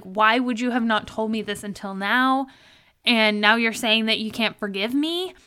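ordinary talk

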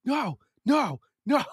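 a frantic silent no in your head; inner panic. Retold or imitated. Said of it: "in my head, I was like".

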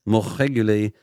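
put-on comic French accent with laughter creeping in; hammed-up French pundit, nearly cracking up; thick put-on French accent. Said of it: "trying to do a French accent".

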